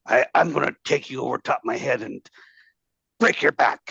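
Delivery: lightly imitated French accent. Staff sergeant threatening Thompson, imitated French accent. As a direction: put on a rough hint of French. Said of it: "French kind of accent or whatever".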